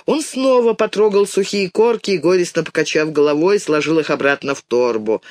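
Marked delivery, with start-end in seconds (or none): none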